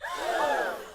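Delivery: sharp high gasp; dramatic gasp. Real, not acted. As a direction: gasp in shock. Reaction to finishing book.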